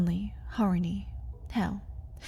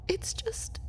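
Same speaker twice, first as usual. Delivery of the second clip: breathy broken whisper; 9 semitones higher, 11 dB quieter than usual. Acted. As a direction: trail off into a whisper. Vampire breaking off in distress.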